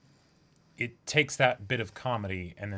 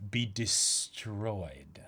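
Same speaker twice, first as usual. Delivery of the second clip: slow ominous; 3 semitones lower, 6 dB quieter, much slower than usual. Real, not acted. Gleeful prediction, drawn out.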